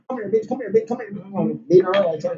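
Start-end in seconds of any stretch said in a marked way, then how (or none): 0.1-1.1 fight taunt. Retold or imitated. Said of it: "I'm talking about".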